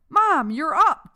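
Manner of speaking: high, loud, slow joyful cry; elated. A narrator's reading. Son running to hug his mother, elated. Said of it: "Dexter elated".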